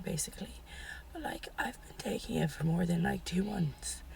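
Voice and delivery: real lost voice, mostly a whisper; words pushed out through a voice that has gone; whispering with no voice left. Real, not acted. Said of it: "my voice went".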